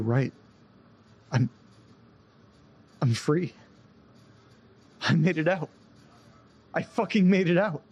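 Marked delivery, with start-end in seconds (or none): 3.0-7.8 rising, disbelieving joy at being free; elated relief. Acted. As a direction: let the realisation burst out, higher each line. Realises he escaped the house.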